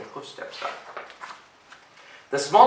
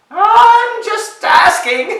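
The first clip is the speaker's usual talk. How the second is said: very high, loud, stretched squeal; flustered little ogre; 15 dB louder, much slower than usual. A narrator's reading. Ogre excusing himself to snarling goat, high and flustered. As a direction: back off with a nervous laugh. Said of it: "stuttered the little ogre".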